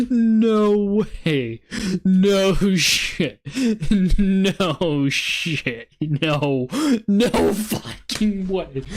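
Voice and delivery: can't believe what he's seeing; stunned disbelief. Real, not acted. Streamer seeing his tool call the top, stunned.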